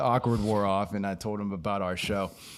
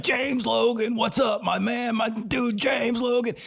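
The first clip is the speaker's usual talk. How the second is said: Stone Man shouting; 13 semitones higher, slower than usual. Retold or imitated. Stone Man hailing him on the street, high shout. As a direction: yell the wrong name like an old pal.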